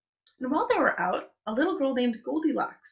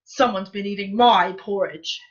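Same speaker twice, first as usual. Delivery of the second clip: great big Papa Bear voice; 7 dB louder than usual. A narrator's reading. Papa Bear finds his chair used, great big voice. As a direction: boom it out as an indignant big bear. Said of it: "in his great big voice".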